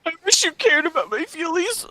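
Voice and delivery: high, pleading whine; whiny plea. Acted. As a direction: pretend heartbroken begging.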